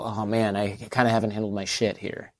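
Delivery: hushed self-admission; imagined private realization. Retold or imitated. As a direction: drop the volume for a sheepish inner thought.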